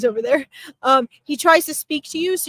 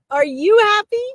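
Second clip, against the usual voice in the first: louder, much higher imitation of a jubilant dad; jovial, emphatic questioning; 8 semitones higher, 6 dB louder than usual. Medium relays dad pressing Liz about her happiness, emphatic jovial questioning. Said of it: "big booming laughter and he's like".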